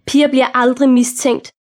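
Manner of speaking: ordinary delivery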